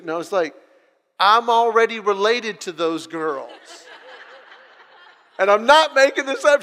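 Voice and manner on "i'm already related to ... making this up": loud, higher-pitched comic indignation; exasperated protest. Retold or imitated. Son objecting to mom matching him with relatives, loudly exasperated. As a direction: protest loudly, building in disbelief.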